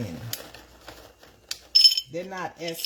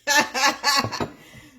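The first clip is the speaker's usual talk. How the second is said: loud high laugh; 12 semitones higher, 9 dB louder than usual. Real, not acted. Laughing at own French bit.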